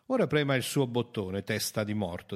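loud, forceful challenge; defiant taunt. A narrator's reading. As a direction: throw down a challenge. Sfida sprezzante a Dagenham. (sfida forte e provocatoria)

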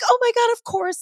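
high, bright gushing yes; gushing back at the fans; excited reply. Retold or imitated. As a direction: squeal back, flattered and thrilled. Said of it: "And I'm like".

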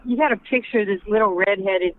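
ordinary delivery

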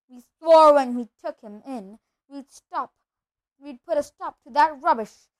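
loud, forceful indignant protest; indignant outburst. Acted. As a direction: lay down the law loudly. Uncle Vernon's angry outburst.

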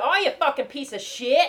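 high, loud mock-Cockney insult; weird accent. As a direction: shout it in a bad Cockney accent. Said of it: "with some weird accent".

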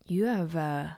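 soft, slightly low, creepy admirer; slow, hushed mock flattery; retold boss. Retelling the boss's flirty text, soft and slow. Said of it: "and he's like".

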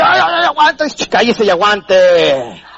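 mock-suffering stomach voice; complaining stomach. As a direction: whine in pain, then cut yourself off laughing.

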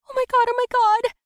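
very high, loud panicked shriek; panicked yell. Acted. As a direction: scream in total panic. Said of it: "I yelled under my breath".

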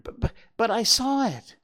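high, loud stammer; stuttering. A narrator's reading. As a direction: stutter out the words in alarm. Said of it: "Bambi stuttered".

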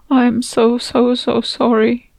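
high, slow, tearful apology; choked out. Acted. Narrator comforts the grieving mother, choked with tears. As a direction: choke the words out through tears. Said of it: "I choked out".

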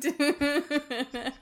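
high rapid-fire stuttering gun noise; machine-gun imitation. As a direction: rattle off a mock burst of gunfire. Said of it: "As she mimics a, a, a machine gun".

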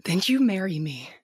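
ordinary delivery

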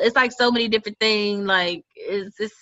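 usual speaking voice

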